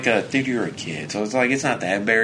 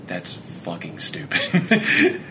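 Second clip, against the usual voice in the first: laughing retort; 3 semitones lower, much slower than usual. Retold or imitated. Laughing reply, slow.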